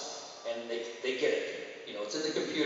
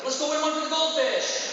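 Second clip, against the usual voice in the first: much higher, louder pep-talk shout; hyped rally cry; 6 dB louder than usual. Retold or imitated. Coach rallying little kids for a goldfish, hyped-up shout. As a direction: fire up the team like it's a real cause. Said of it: "I'm like".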